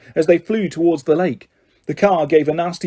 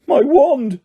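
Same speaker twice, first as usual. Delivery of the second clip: shaky voice; 9 semitones higher than usual. A narrator's reading. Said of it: "said Ron in a shaky voice".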